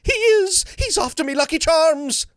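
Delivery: high, loud, lilting Irish leprechaun; mischievous cartoon leprechaun protecting his charms. Shown as a demonstration. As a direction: sing it out like a cheeky leprechaun. Leprechaun slogan performed.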